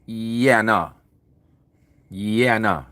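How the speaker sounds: mock impression of a friend saying yeah nah; Aki Al's yeah nah. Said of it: "Just like my Aki Al would say".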